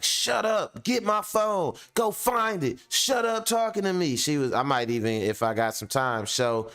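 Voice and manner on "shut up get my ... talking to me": put-on high, bossy girlfriend snapping orders; shrill-free high bossy demands, ordering him around. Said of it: "She would be like".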